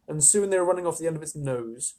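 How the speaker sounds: plain reading voice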